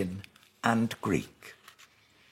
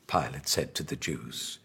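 ordinary delivery, nothing marked

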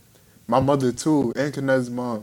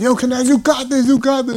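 hyped cheer; 9 semitones higher, 4 dB louder than usual. Retold or imitated. Cheering friend on.

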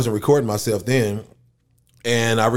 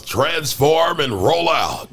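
drawn-out, louder heroic rallying call; Optimus Prime; 5 semitones higher, 3 dB louder, much slower than usual. Acted. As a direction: call the troops to action, long swooping words. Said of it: "Optimus Prime".

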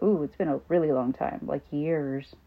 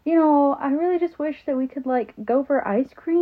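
put-on much higher, slow and pleading like a little boy; sweet kid voice; 8 semitones higher, 4 dB louder than usual. Son sitting in the bathroom asking mom for ice cream, high sweet kid voice. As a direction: coax mom like a lonely child. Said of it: "he just tells me".

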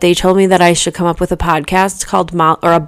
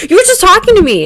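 higher, louder mock disbelief; indignant surprise; 12 semitones higher, 4 dB louder, faster than usual. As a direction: act baffled at a toddler's snub. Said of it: "I was like".

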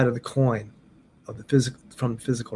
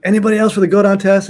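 louder, higher announcement to a room; raised-voice retold call; 6 semitones higher, 5 dB louder, faster than usual. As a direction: imitate a teacher asking for volunteers. Teacher calling out to the room.

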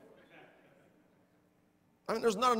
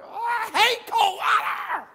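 high, slow, full-voiced shout; exasperated outburst; 13 semitones higher, 8 dB louder, slower than usual. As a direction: bellow it out as the cold water hits. Acted outburst under cold shower.

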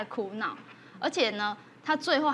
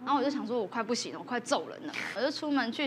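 ordinary delivery

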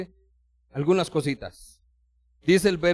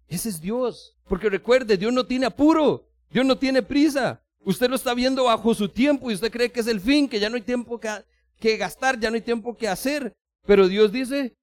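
higher, louder sermon build; fervent preaching; 8 semitones higher, 6 dB louder than usual. Acted. Preacher urging the congregation that God has no hurry, fervent. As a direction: press the point home to the congregation.

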